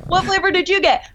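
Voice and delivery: high-pitched, over-bright mock question; frantically chirpy retold question; manic small talk. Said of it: "like, manic".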